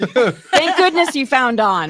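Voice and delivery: laughing through the words, slowly; teasing him while cracking up. Real, not acted. Teasing a friend about his pickup line, laughing.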